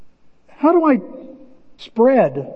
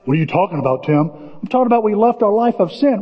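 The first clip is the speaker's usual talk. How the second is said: normal talking voice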